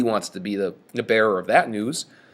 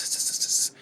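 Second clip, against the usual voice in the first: 1 hissing s sound; 5 dB quieter than usual. Shown as a demonstration. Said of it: "S's is fantastic".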